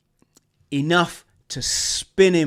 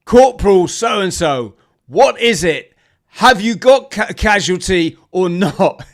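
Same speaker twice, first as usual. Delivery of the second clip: impatient officer; 6 semitones higher, 9 dB louder than usual. Retold or imitated. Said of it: "the O C's voice going".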